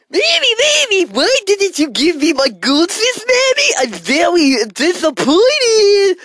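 put-on very high, loud sobbing child; whiny brat. Kid demanding a goldfish, whining loudly. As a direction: wail about the goldfish. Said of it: "I cry like a brat".

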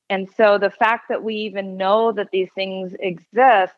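usual speaking voice

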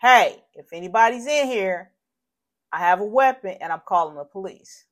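re-enacted yell to whoever might be inside; yelled warning. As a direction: call out forcefully through the house. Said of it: "So I yelled".

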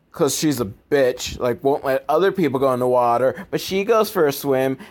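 normal talking voice

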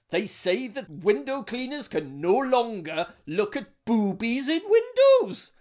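building speech. Acted. Union leader speech builds.

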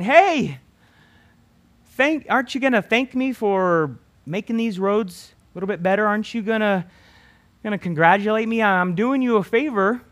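loud, high, demanding voice of a road sign; a sign begging to be congratulated; talking road signs. Pretend. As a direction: whine for thanks like a needy sign.

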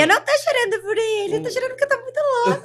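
quoting herself, rising high and fast; mock tearful insistence; retold crying protest. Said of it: "como eu disse lá no reality".

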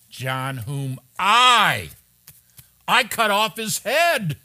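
slow, loud and emphatic, rising high. Retold or imitated. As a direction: Herod insisting, every word hammered. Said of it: "It's very emphatic in the Greek text".

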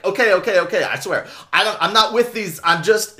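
panicked pleading, high and loud; freaking out. Retold or imitated. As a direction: babble loudly to save your own skin. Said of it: "he freaks out and starts to sell out".

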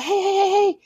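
high-pitched urgent "hey hey" to snap him out of it; urgent calling out. Acted. Wife pulling husband out of the tub, urgent high call.

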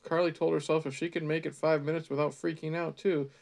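ordinary talk, nothing marked

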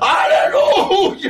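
very high, drawn-out loud cry; real fervent shout; hallelujah shout. Real, not acted. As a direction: belt it out slowly in praise. Shouts praise at the climax.

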